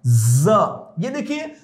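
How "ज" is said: ज as a long buzzing z sound; sound demonstration. Shown as a demonstration. Teacher introduces the next sound, sustaining ज as z. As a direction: hold the z of ज, then release it. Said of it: "अगला sound है".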